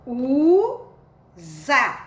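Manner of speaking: "Usa" esticado em "uuu... za"; leitura silabada. Shown as a demonstration. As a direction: leia "Usa" separando as duas sílabas. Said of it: "é uma sílaba e aqui é outra sílaba". ("Usa" silabado, com som de Z)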